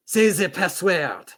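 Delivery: slow, high, loud command. Acted. Demure demanding the password, commanding voice. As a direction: give the order firmly and slowly. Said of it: "Demure commanded".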